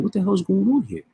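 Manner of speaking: high, indignant exclamation; an outraged high voice; pissed off. Retold or imitated. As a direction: demand it like someone fed up. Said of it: "Pissed off".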